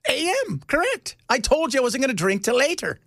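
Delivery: high put-on Irish wife's voice, scolding; mock Irish wife, exasperated. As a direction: nag him like a sharp Irish wife. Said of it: "She goes".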